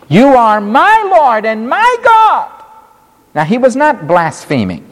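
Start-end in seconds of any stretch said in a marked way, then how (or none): 0.1-2.5 higher, ringing proclamation; Thomas's confession. Acted. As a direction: cry it out in worship. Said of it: "Thomas said to him".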